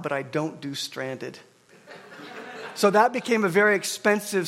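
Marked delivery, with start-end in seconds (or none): 0.0-1.4 lower, softer, slow, stress on don't; don't and stranded drawn out slowly, softly; firm declaration. Retold or imitated. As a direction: lay it down flat and final, leaning on don't. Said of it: "she says this".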